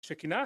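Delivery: Shekinah with sh, asked; "Shekinah" said she-KEE-na with sh, rising as a question; "Shekinah" she-KEE-na, asked with a questioning rise. Shown as a demonstration. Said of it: "¿cómo la pronuncian?".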